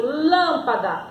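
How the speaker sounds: "lâmpada" slowly, with a long first syllable LÂM; stress on LÂM. Shown as a demonstration. Teacher demonstrating pronunciation to pupils, LÂM stressed and stretched in "lâmpada". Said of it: "Olha como eu pronuncio".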